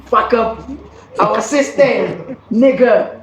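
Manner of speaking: ordinary talk, nothing marked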